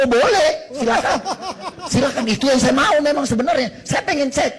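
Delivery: loud, high, eager reply; eager consent. Retold or imitated. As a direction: jump at the offer at high volume. Eager yes to doctor's retest offer. (menyanggupi dengan lantang dan antusias)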